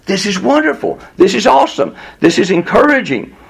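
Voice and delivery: high, eager amazement; impressed delight. Retold or imitated. Onlookers marvelling at the crowd, high and eager. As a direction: gush over the scene. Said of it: "we might say".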